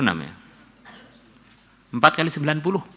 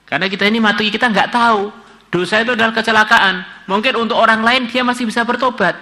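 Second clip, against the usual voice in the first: much higher, pressing warning voice; fervent preaching; 10 semitones higher, 3 dB louder than usual. Real, not acted. Preacher warning the congregation not to test sin, urgent fervent preaching. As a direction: preach it urgently, climbing in pitch.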